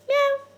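squeaky high-pitched meow; loud, high mock cat. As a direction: mew like a kitten. Toy cat speaks.

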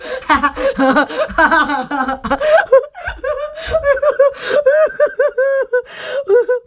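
high, crying-with-laughter howl; real tears-of-laughter laugh; helpless laughing fit. Real, not acted. Still laughing at herself, crying with laughter.

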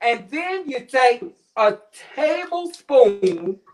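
ordinary talk

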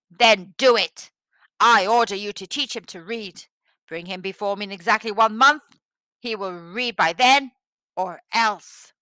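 loud, forceful tyrant's order; stern command. A narrator's reading. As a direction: order him loudly, with menace. Tyrant gives an angry order.

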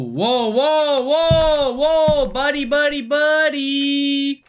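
alarmed rising whoas; startled. Real, not acted. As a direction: back off in alarm. Startled by a figure.

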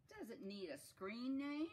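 high, loud storybook question; curious character. Acted.

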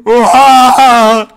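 high loud startled oha; shocked scream. Real, not acted. Gamer reacting to the horror scene, shrieking. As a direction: blurt it out in fright.

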